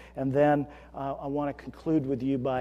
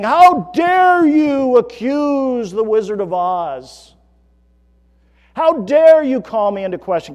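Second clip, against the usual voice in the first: loud, slow bellowing wizard, pitched up; booming wizard; 8 semitones higher, 15 dB louder than usual. Retold or imitated. Preacher voicing the Wizard yelling at Dorothy, booming bellow. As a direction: thunder it out like the angry Wizard of Oz. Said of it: "he's yelling at them at the top of his lungs".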